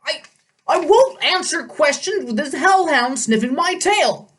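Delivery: put-on high, jittery old satyr voice; nervous satyr. A narrator's reading. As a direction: stammer out, scared of the dog. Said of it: "knees started knocking".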